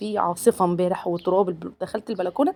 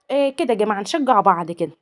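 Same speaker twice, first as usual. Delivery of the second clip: ordinary talk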